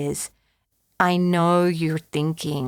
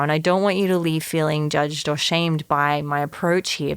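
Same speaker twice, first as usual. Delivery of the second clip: ordinary talk, nothing marked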